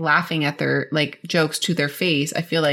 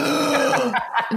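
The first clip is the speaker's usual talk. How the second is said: sharp shocked gasp, pitched up; mock gasp; 8 semitones higher than usual. Mom gasping at her toddler's swear word, mock shocked.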